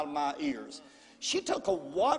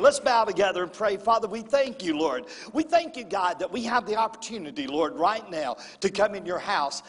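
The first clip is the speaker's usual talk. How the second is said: congregational prayer; 2 semitones higher, 3 dB louder than usual. Acted. Pastor leads prayer.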